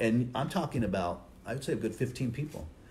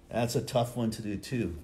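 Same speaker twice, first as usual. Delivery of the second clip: ordinary delivery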